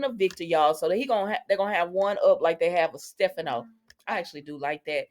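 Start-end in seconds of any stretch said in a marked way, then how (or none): none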